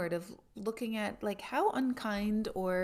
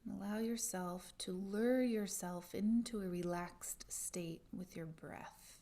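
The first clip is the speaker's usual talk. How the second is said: guided meditation; 8 dB quieter than usual. Acted.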